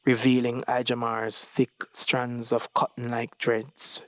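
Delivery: ordinary delivery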